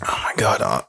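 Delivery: breathy whisper, barely voiced; whispered to himself. Real, not acted. Stuck on a basketball grid, whispering to himself. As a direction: mutter it under your breath in panic.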